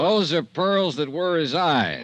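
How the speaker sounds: slow, mock-grand recital of the line; finishing the verse. [name] finishing Ralph's Shakespeare quote, slow and mock-grand. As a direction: ham up the last line a little.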